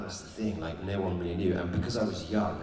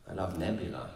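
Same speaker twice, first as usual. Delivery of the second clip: normal talking voice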